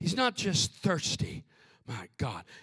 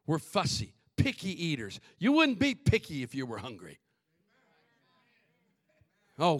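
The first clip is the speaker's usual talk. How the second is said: usual speaking voice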